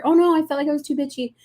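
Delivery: high, quick mock regret; mock dismay. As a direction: fret hurriedly over overdoing it. Acting out swinging between extremes.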